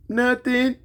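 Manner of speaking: put-on small high kid's reply; lightly voiced sulky child. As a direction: mumble it like a little boy. Grandson answering on the phone.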